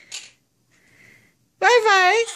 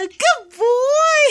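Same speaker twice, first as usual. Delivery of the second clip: high, delighted praise with a laugh; praising the bird; 8 semitones higher than usual. Real, not acted. Owner praising her parrot, high and delighted. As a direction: beam it out to the bird.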